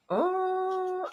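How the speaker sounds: long, high cooing oh; admiring oh. Real, not acted. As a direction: let out a drawn-out high oh of delight. Admiring the paper designs.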